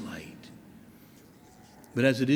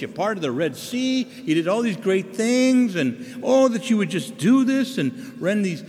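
raised, imploring plea to God; pleading prayer voice; 7 semitones higher than usual. Retold or imitated. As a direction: beg heaven for great signs. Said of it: "notice it says".

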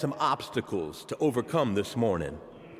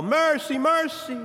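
high loud beggar's cry; begging call; 12 semitones higher, 4 dB louder than usual. Retold or imitated. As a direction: call out for mercy to passersby. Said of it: "he'd call".

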